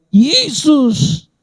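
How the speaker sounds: imploring call. Acted. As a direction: call his name like a prayer. Voiced prayer calling Jesus.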